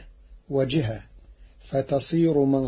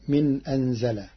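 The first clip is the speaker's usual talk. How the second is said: ordinary delivery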